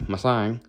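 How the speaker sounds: Mustang said as "muh-sighng", losing its T; garbled pronunciation. Shown as a demonstration. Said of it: "you'd have no clue what I was saying".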